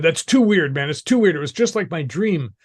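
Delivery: high, agitated disbelief; acting out someone thoroughly weirded out; retold freak-out. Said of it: "and he freaked out".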